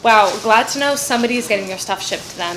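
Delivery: sarcastic. Real, not acted. Sarcastic about packages.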